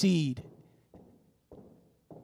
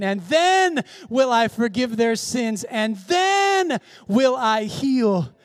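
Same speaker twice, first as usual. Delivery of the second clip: raised, drawn-out key words; preaching at full force; 6 semitones higher, slower than usual. Real, not acted. Preacher leading the congregation through scripture, emphatic and fervent. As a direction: hammer "if" and "then" at the congregation.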